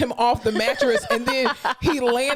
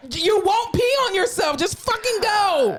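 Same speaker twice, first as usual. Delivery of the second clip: exasperated high nurse; 10 semitones higher than usual. Retold or imitated. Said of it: "And I'm like".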